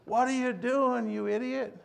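imitating an annoyed policeman, high; imitating angry cop. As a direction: replay the policeman's yell, half-hearted. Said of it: "he's yelling at me".

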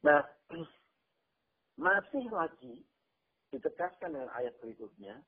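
ordinary talk

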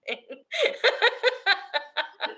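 long high giggle; falling giggle; high laugh. Real, not acted. Laughing at the story.

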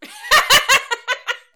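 loud squealing laugh; high burst of laughter. Real, not acted. Laughing at joke.

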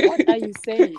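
laughed-out incredulous question; laughing retelling. Retold or imitated. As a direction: retell their bafflement through laughter. Retells reaction while laughing.

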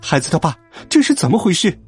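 high, frantic string of questions; clamoring, alarmed mother. A narrator's reading. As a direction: fuss in a panic over a hurt son. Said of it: "又是一番大呼小叫".

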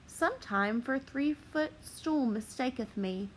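ordinary delivery

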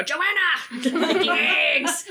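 high, laughing villain's shout; hammed-up McLeach yell, laughing; McLeach impression. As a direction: call out like a cartoon poacher, cracking up. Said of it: "for him to just go".

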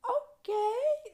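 very high, drawn-out squeak of okay; apprehensive okay. Retold or imitated. Swimmer retelling being told she's first, apprehensive squeak.

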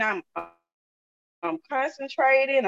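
ordinary delivery

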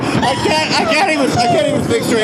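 high-pitched, laughing too hard to talk; hammed-up helpless laughter. Host in the show's laughing routine, hammed-up hysterics. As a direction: gasp out words through overdone hysterics.

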